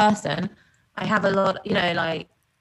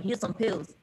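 put-on doctor's line in a light American accent; 7 dB quieter than usual. Retelling the doctor, a light American accent. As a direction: hand over pills like an American doctor. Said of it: "I don't know why I'm doing American".

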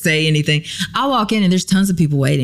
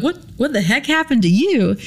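high, startled exclamation; lightly imitated shock; shocked friend; 10 semitones higher than usual. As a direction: blurt it out in alarm. Rebecca arriving, shocked.

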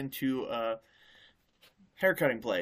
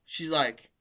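normal talking voice

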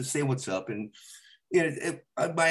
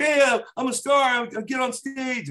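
put-on much higher, slurred drunk swagger; drunk bragging; 12 semitones higher, 3 dB louder than usual. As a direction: play yourself coming home drunk and cocky. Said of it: "when you come home drunk".

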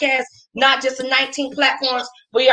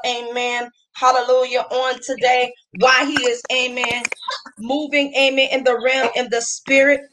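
ordinary delivery

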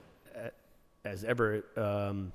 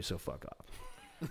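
slow, soft near-whisper "fuck off"; low, breathy dismissive aside; muttered dismissal; 6 semitones lower, 16 dB quieter, much slower than usual. Real, not acted. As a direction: mutter it under your breath. Dismissing the league guys.

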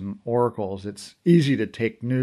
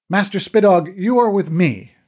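louder and higher, a firm order; commanding; 7 semitones higher, 6 dB louder than usual. A narrator's reading. Mom ordering Master Spitog to her side, commanding. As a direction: give the order, no room for argument. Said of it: "Mom commanded".

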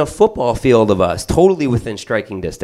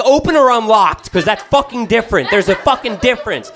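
higher, exasperated point; mock indignant; 12 semitones higher than usual. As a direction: argue the fine legal point. Arguing with wife.

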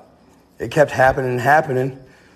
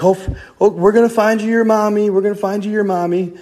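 high, hurried mock panic; imitating someone freaking out; 8 semitones higher than usual. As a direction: act out a panicked promise to a child. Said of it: "he freaks out".

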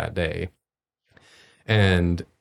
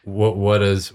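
usual speaking voice

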